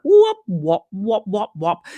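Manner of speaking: imitated wobbling whops, falling away; repeated whop sounds fading down; wobble board noise. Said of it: "make a little wobble board noise".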